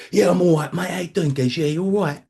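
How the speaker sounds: high, bright-faced mock cheer to his mates; forced cheerful mate voice; fake cheery mate greeting. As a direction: put on a cheerful front, high and chirpy. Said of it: "I'll be like".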